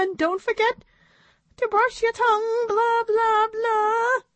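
very high puppet voice droning on; high, monotonous puppet blah-blah; monkey puppet. A narrator's reading. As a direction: rattle off a tedious lecture as a squeaky monkey.

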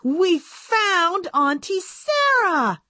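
high, slow, bursting with joy; thrilled young doll announcing the find; excited blurt. A narrator's reading. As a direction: blurt out the big news. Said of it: "so excited that all she managed to blurt out".

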